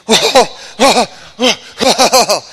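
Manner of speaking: loud panting oh oh groans; straining groans. Retold or imitated. Preacher on the treadmill, loud strained groaning.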